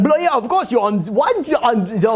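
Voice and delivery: high, garbled Manchester gabble; exaggerated northern English jabber; heavy Manchester English. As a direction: rattle off an incomprehensible mock Manchester accent. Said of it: "the heavy English accent".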